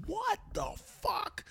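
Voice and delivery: high, slow, stunned disbelief; mortified what the fuck. Retold or imitated. Girlfriend on speakerphone, mortified, in stunned high disbelief. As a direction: drag it out in shocked horror. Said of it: "Megan is, like, mortified".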